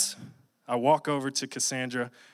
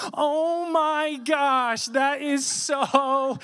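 high, slow, squealing gush; sudden high squeal of delight; gushing girl cop; 12 semitones higher, 4 dB louder, much slower than usual. Retold or imitated. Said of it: "turns from serious mode and just goes".

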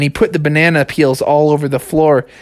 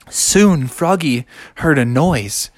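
ordinary delivery

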